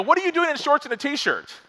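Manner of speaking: high, startled call across the snow; incredulous call. Retold or imitated. Calling out to the guy.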